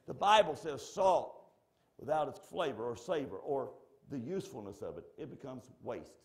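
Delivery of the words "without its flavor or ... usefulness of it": ordinary talk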